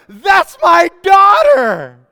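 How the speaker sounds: long, high, full-out shout; proud scream. Retold or imitated. Proud dad at his daughter's soccer game, screaming it out. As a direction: bellow it slowly across the soccer field. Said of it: "I just scream it out".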